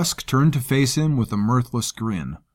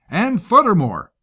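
higher, brash commander voice; lightly voiced coarse soldier; gruff commander voice; 9 semitones higher than usual. Rusk voiced by narrator.

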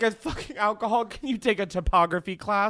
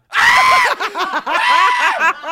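shrieking laugh; 9 dB louder than usual. Real, not acted. Huge burst after Justina reveal.

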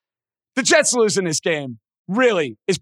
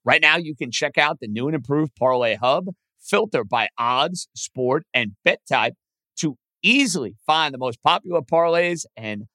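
ad read; 5 semitones lower than usual. Acted. Host reads sponsor ad.

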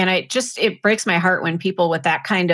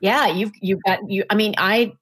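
normal talking voice

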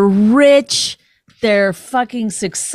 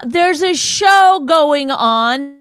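higher, louder and slow, hammering it home; exasperated rant; 7 semitones higher, 5 dB louder, much slower than usual. Real, not acted. Host ranting about award speeches, loud and slow. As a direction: tell them off like you mean it.

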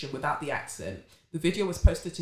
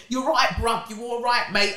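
mock London accent; 5 semitones higher, 4 dB louder than usual. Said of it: "I'm just doing accents".

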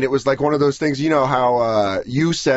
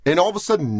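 ordinary talk

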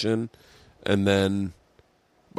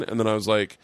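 ordinary talk, nothing marked

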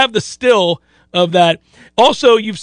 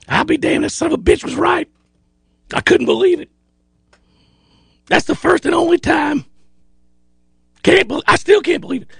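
higher amazed disbelief; amazed admission; 6 semitones higher than usual. Retold or imitated. Coach telling the host he was wrong, amazed. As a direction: admit it in grudging shock.

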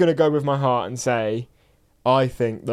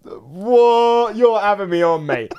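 raised, disbelieving outburst; incredulous; 5 semitones higher, much slower than usual. Real, not acted. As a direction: react as if being wound up. Reacts to outlandish claim.

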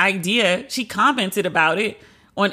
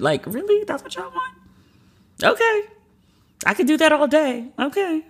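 incredulous; 9 semitones higher than usual. Real, not acted. Incredulous at audience wish.

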